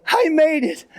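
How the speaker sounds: high, relieved little cry of victory; triumphant whisper-cheer. Retold or imitated. Dad reaching the doorway, high relieved triumph.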